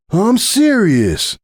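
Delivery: slow, high, hammed-up hurt protest; mock pained. Stallion teasing his marefriend with mock pain. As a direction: drag it out like a fake wounded pony. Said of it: "faking a long, pained frown".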